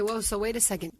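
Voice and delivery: usual speaking voice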